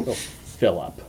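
ordinary delivery

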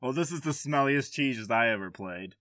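normal talking voice

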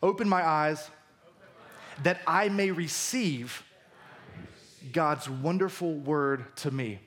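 slow, deliberate prayer line for the church to repeat; paced call to repeat; slow recited line. Shown as a demonstration. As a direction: lead the congregation line by line, slowly. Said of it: "Repeat these lines after me".

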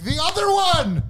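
high, stretched mock disbelief; shocked outcry. Patient retells learning of second cut, shocked outcry. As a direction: re-enact alarmed surprise, pitch leaping. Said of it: "I'm like".

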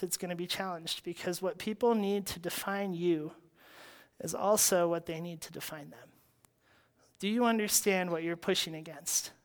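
ordinary delivery

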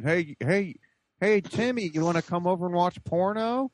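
high, sleazy come-on voice; creepy caller voice. Retold or imitated. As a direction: lure him in, high and creepy. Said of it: "like, a face caption".

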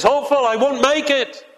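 a drowning man's plea, lightly voiced. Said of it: "he's crying out. He says".

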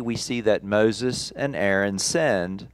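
normal talking voice

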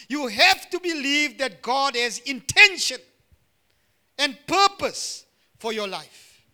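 loud, emphatic pulpit declaration; impassioned preaching. Real, not acted. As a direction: drive each phrase home to the congregation with force. Preaches God's purpose at force.

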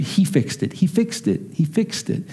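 softly repeated, gentle awe; hushed wonder. Retold or imitated. Father correcting his memory of his son's words, soft. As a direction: quietly echo a child's amazed words.

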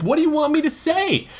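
put-on a flustered young man losing patience; exasperated plea. A narrator's reading. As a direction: push back, cornered and rattled. Flustered boy in argument.

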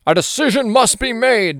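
high, loud, hammed-up dramatic declaration; grand, theatrical mock movie quote; movie-style pronouncement. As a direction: declare it like a big movie line. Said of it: "sounds like something from a movie".

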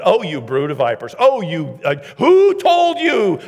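mounting sarcastic rebuke, ending high and drawn out; loud sarcasm. Acted. Preacher quoting a rebuke to the vipers, loud sarcasm. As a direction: build to a loud, stretched, sarcastic question. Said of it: "Jesus wasn't sarcastic".

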